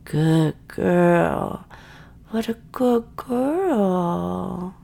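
ordinary talk

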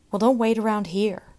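loud savage shout. A narrator's reading. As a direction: snarl it loudly at her. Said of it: "cried the boy savagely".

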